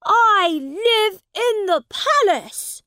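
very high, loud, slow protest; crying out. A narrator's reading. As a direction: proclaim it in a high cry. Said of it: "he cried out".

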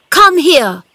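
high, loud urgent order; shouted command. A narrator's reading. Boy calling Dickon to his wheelchair, shouting. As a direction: yell for him to come. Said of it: "he shouted to Dickon".

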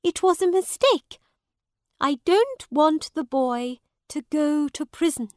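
high, loud, pleading apology; begging. A narrator's reading. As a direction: beg the judge as a woman. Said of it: "begged the woman".

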